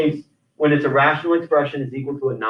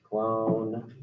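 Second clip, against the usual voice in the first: low flat robot voice; 9 semitones lower, 9 dB quieter than usual. Shown as a demonstration. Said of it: "I say it like a robot".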